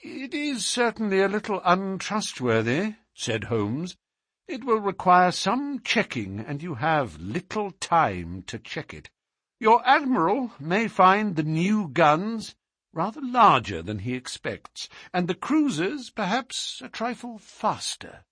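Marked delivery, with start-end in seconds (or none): 0.0-2.9 lightly voiced detective, drily amused; a hint of a higher, teasing sleuth; Holmes. A narrator's reading. Detective teases the ruined captive. 4.5-18.2 lightly voiced detective, drily amused; a hint of a higher, teasing sleuth; Holmes. A narrator's reading. Detective teases the ruined captive.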